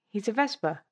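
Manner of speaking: ordinary talk, nothing marked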